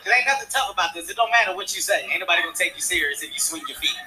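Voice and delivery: usual speaking voice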